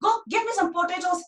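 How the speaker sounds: flustered mother. Acted. Busy mother shooing Dhani off to fetch potatoes. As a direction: shoo him off between rotis. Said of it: "she said, and chased him off".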